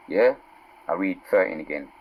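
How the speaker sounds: ordinary delivery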